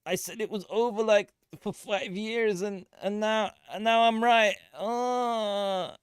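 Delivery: high, mocking voice of smug bears; lampooning a smug doomsayer's victory lap; gloating bears. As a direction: gloat like a bear who called the crash. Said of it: "They were like".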